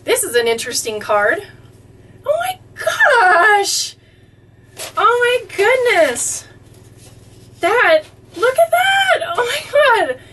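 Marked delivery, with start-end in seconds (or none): 2.2-10.2 very high, slow, breaking gasps of joy; squealing oh my gosh, voice cracking; overwhelmed delight. Real, not acted. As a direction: near tears with surprise. Overwhelmed by a drawn picture.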